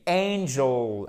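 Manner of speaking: ANGEL as AIN-jul, opening like "oseille"; diphthong. Shown as a demonstration. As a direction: stretch the first diphthong of "Angel", stress it. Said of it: "le même E que dans oseille".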